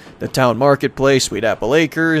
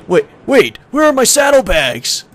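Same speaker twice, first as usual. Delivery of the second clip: high, hammed-up fretting; high-pitched pretend panic; mock panic; 9 semitones higher, 4 dB louder than usual. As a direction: act alarmed over missing bags. Acting out character missing bags.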